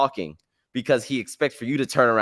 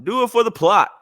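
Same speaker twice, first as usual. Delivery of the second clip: loud, high rallying cry; 10 semitones higher, 6 dB louder than usual. Shown as a demonstration. Listeners urged to take risks, a loud high rallying cry. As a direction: shout it higher and louder. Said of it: "I believe in the theory of do it for the plot".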